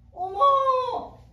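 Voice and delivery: long, high, loud gasp of recognition; stunned exclamation. Retold or imitated. Woman recognizing Jesus in her vision, stunned exclamation. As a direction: cry out in amazement, drawing it out.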